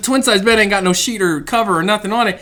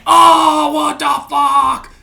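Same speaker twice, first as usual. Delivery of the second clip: loud, slow drawn-out disbelief; stunned shout; 8 semitones higher, 8 dB louder, much slower than usual. Retold or imitated. Marine reacting to miscarriage news. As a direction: let it sink in loudly. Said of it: "I'm like".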